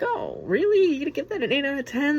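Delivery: high, incredulous mocking squeak; mock doubter. Imagined viewers doubting his eight out of ten, mocking high disbelief. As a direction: scoff at the score in a silly high voice. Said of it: "suppose people are like".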